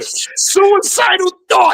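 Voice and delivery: laughing. Real, not acted. Laughing repeat of the joke.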